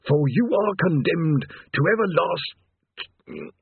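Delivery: very slow doom pronouncement; demon threat. Acted. Demon addresses the damned, very slow doom voice. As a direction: intone like an ancient horror.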